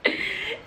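breathy witch cackle; witch laugh. Real, not acted. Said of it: "It's a witch laugh for me".

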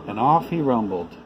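plain reading voice

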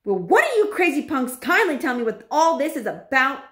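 indignant shout. A narrator's reading. Boy demanding answers from the witches, shouting. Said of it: "shouted Bruno".

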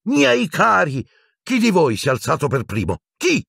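high anxious seer's shriek; shrill alarmed old prophetess crying out. A narrator's reading. Trelawney shrieking at the boys who stood first, alarmed. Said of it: "cacciò uno strillo".

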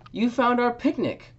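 high, loud happy cry; Buzz crying out. Acted. Buzz greeting the fly at the picnic, high happy cry. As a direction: call out with delight. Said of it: "cried Buzz".

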